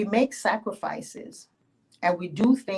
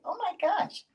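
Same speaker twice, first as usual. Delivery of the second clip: high, gasping oh my gosh; gushing surprise; 13 semitones higher than usual. Acted. Yemonja gushing to the farmer, high and amazed. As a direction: swoon over the big vegetables. Yemonja gushes over vegetables.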